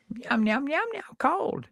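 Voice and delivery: high meowing puppet cat; Henrietta Pussycat. Retold or imitated. Imitating Henrietta Pussycat's meow between words. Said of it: "inserting meow before her sentences".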